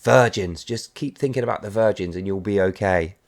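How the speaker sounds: low and soft, a hushed mock lullaby; soft self-soothing. Little Jimmy lulling himself to sleep, soft and low. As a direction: murmur it to yourself to fall asleep.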